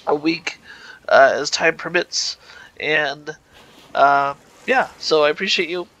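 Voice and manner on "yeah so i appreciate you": ordinary talk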